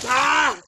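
high loud yelp from icy water; cold shock yell. Real, not acted. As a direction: yelp as ice water hits. Ice water poured over head.

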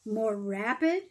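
usual speaking voice

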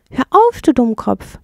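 a high, loud alarmed yell; a panicked shriek at a foolish friend; screaming. Acted. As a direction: shout him down in alarm. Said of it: "schrie die Ratte".

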